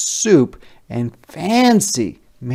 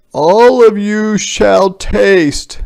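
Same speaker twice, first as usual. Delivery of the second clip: higher, louder, slow grand soldier; soldiers announcing; 10 semitones higher, 10 dB louder, slower than usual. A narrator's reading. Soldier inviting the whole village, higher, louder and slow. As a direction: proclaim it slowly to the crowd. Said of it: "the soldiers said".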